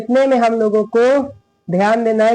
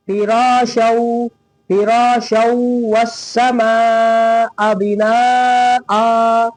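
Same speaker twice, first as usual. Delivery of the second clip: slow recitation with very long held vowels; Quranic recitation; much slower than usual. Shown as a demonstration. Teacher reciting the next phrase, slow with held vowels. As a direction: recite "फिराशौ वस्समाअ बिनाआ" drawing out each long vowel.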